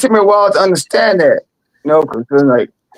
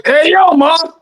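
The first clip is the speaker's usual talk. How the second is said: aggressive New York tone; 9 semitones higher, slower than usual. Retold or imitated. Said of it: "that aggressive tone".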